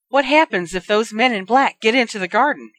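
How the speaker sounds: high, loud, frightened demand; shrill with fear. Acted. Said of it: "her voice shrill with fear".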